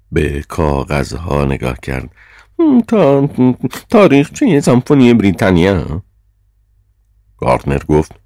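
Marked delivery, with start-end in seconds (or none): 2.6-6.0 high, loud reading of a title; chairman's raised, high voice; Sir John, scornful chairman. A narrator's reading. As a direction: read it out high and pointed.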